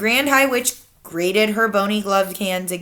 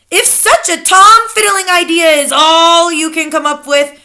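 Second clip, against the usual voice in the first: louder, higher booming witch voice; thundered; 9 semitones higher, 9 dB louder than usual. A narrator's reading. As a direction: thunder contempt at them. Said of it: "thundered the Grand High Witch".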